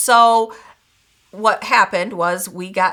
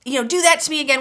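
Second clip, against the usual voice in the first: mock blow-up; 7 semitones higher, faster than usual. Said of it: "I would have either blown up".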